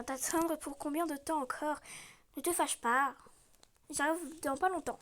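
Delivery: ordinary delivery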